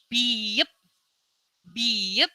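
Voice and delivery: biep said clearly with a rise; letter name demo. Shown as a demonstration. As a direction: model biep twice.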